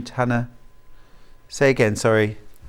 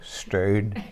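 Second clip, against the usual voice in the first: laughing Northern Irish Stern, softer; Stern in a Northern Irish accent through a laugh; 7 semitones lower, 8 dB quieter than usual. Retold or imitated. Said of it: "have to say it in a Northern Irish accent".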